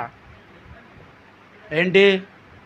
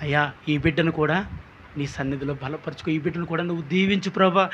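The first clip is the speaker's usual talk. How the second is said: much softer, humble pleading prayer; 4 semitones lower, 9 dB quieter than usual. Retold or imitated. Abraham quietly asks God to bless the boy. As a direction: pray gently for the boy. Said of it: "Abraham చేసిన చిన్న ప్రార్థన".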